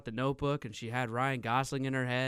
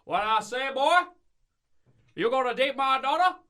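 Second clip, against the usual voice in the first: hammed-up Southern dad with an over-the-top accent; cartoony Southern accent; 10 semitones higher, 7 dB louder than usual. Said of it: "the most cartoony Southern accent I've ever heard".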